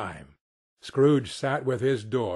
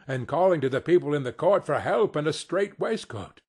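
ordinary delivery, nothing marked

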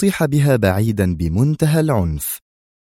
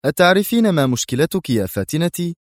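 mocking question, pitched higher; 7 semitones higher than usual. A narrator's reading. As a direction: taunt her with the question. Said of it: "وهو يقول ساخرا". (بسخرية)

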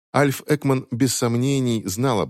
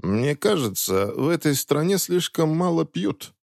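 displeased; 7 semitones higher than usual. Acted. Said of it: "раздался недовольный голос".

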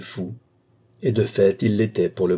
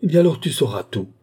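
defiant outburst; 6 semitones higher, 5 dB louder than usual. Acted.

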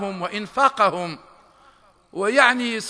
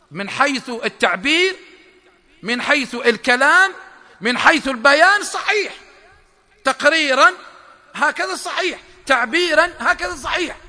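raised, higher preaching voice; emphatic preaching; 5 semitones higher, 5 dB louder than usual. Real, not acted. Lecturer stressing to listeners that hypocrites' words only sound right, emphatic preaching. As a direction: preach each point with rising force.

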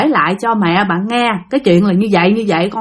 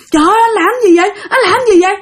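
high, fast, frantic cries; panicked pleading; 10 semitones higher, much faster than usual. Retold or imitated. Girlfriend begging her possessed boyfriend, high fast panic. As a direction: plead in a rush of fear, much higher. Terrified girl hitting boyfriend's back.

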